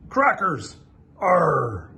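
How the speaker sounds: greedy pirate hollering Arr; pirate cry. Acted.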